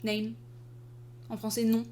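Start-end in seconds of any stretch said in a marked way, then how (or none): none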